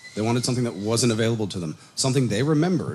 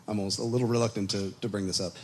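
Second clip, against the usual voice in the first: usual speaking voice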